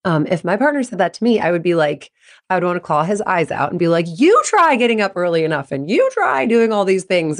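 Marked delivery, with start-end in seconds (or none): 4.1-7.4 defensive retort, hitting YOU with leaping pitch. Acted. Wife snapping back at advising husband, defensive. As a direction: throw it back indignantly. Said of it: "I would get defensive".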